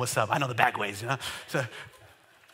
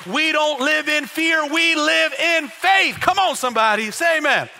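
full-force preaching; 7 semitones higher, 5 dB louder than usual. Real, not acted. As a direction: preach it loud and high to rouse the church.

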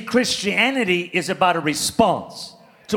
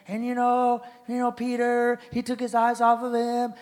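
mock preacher; 6 dB quieter than usual. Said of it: "I've heard preachers in".

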